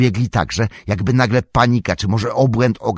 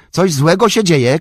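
much higher and louder alarmed cry; 9 semitones higher, 6 dB louder than usual. A narrator's reading. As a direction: leap up and cry out the warning. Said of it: "krzyknął, zrywając się". (zaniepokojony okrzyk, dużo wyżej i głośniej)

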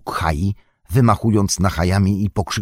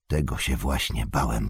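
low, hushed admission; dejected; 8 semitones lower, 8 dB quieter than usual. A narrator's reading. Frodo to his companion as the orcs close in, dejected. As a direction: say it softly and low, as if beaten.

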